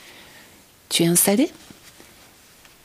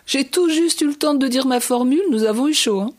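breathless relief; 5 semitones higher, 4 dB louder than usual. Acted. Molo relieved after narrow escape.

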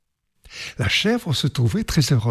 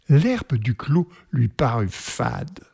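usual speaking voice

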